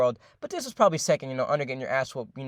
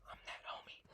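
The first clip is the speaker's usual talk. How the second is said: very soft, whispered brag; hushed little aside to the audience; 28 dB quieter than usual. Real, not acted. Sly aside while telling story.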